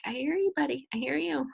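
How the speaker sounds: soothing mom. Retold or imitated. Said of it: "And I'm like".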